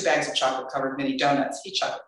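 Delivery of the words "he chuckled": usual speaking voice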